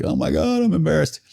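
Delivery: retold tearful whine, jumping up high; mock bawling. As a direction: imitate blubbering, climbing into a high voice. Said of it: "I start bawling like".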